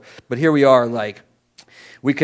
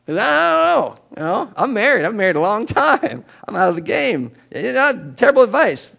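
joking; 8 semitones higher than usual. Real, not acted. Joking about his dating advice.

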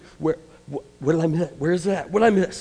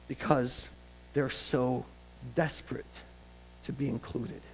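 normal talking voice

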